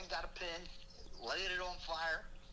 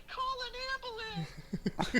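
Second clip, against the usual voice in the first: panicked shriek; 5 dB louder, much slower than usual. Retold or imitated. Panicked daughter calling out.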